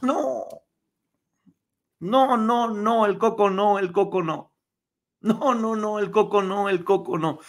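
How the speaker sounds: high, repeated pleading no; hammed-up horror, starting high and easing down; mock alarm. Reacting to Meghan's hormones remark, mock alarm.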